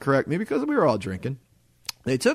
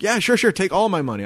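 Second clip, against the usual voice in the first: higher, excited rush to pay; retold giddy consent; eager agreement; 6 semitones higher, 4 dB louder, faster than usual. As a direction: throw the money at them eagerly.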